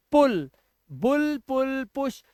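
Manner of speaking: पुल बुल पुश with a short oo, said slowly; drawn-out short oo in पुल, बुल; teacher drills पुल, पुश. Shown as a demonstration. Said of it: "ऊ का उच्चारण दे रहे हैं".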